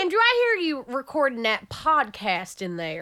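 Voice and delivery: mock Southern nagging; playful Southern busybody; Southern accent. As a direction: put on a Southern twang. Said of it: "maybe she has a Southern accent".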